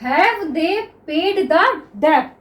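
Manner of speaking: each word drawn out slowly for students; very slow classroom dictation; slow dictation read. Shown as a demonstration. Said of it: "देखिए".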